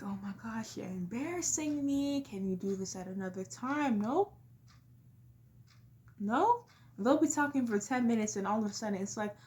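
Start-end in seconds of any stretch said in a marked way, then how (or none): 0.0-4.0 softer, mortified under-the-breath plea; embarrassed mumble. Retold or imitated. Embarrassed kid begging parent to stop, quiet and mortified. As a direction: hiss it quietly so nobody hears. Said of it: "you're just in the store like".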